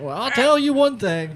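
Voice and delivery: put-on high, loud cranky old man voice. Shown as a demonstration. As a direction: croak it out like a grumpy grandpa. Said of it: "in the old man voice".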